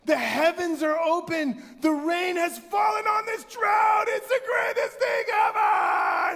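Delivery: high, loud jubilant shout; ecstatic fan. Retold or imitated. Preacher voicing winning fans, jubilant shout. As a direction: cheer like your team just won. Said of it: "they're like".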